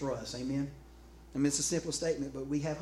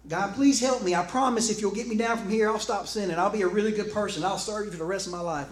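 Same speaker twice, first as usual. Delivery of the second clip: high, loud bargaining plea; desperate promises in a raised voice; frantic pleading; 6 semitones higher, 6 dB louder than usual. Acted. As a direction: beg urgently to be rescued. Jack pleads with God to save him.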